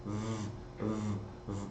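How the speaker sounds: v buzzed softly between teeth and lip; quiet v demo; labiodental v. Shown as a demonstration. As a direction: hold a soft labiodental v. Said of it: "Esse som aqui, lábio dental".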